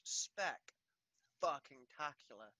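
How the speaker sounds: "spec-fucking-tacular" stretched slowly with every syllable leaned on; drawn-out emphasis. Real, not acted. Bragging about lighting work.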